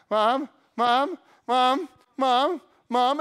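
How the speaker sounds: put-on loud, high, insistent child calling; kid nagging mom. Child in a store pestering mom, loud nagging call. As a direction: keep calling Mom like you won't stop.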